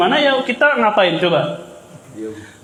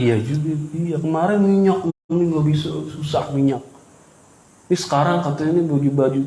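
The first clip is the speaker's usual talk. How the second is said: softer, grumbling wife; lightly voiced complaining wife; 7 semitones lower, 4 dB quieter than usual. Wife complaining to husband about prices, soft grumble.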